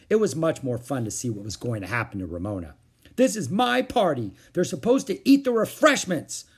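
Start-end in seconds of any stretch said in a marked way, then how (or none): 3.2-6.4 put-on high, loud outraged child; howling tantrum. A narrator's reading. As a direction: howl it in indignant protest. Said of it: "Ramona howled".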